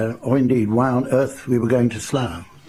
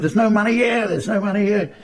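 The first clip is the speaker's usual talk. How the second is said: panicky cry; 10 semitones higher than usual. Retold or imitated. Panicking accomplice retold.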